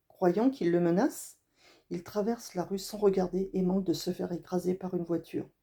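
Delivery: ordinary delivery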